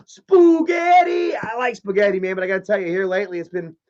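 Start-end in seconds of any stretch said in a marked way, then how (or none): 0.1-1.4 slow, much higher, loud stretched-out yell; drawn-out shout. Acted. Hailing Julie Moon's spaghetti with a long loud yell. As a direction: belt the word out long and high like a cheer. Greets friend eating spaghetti on stream.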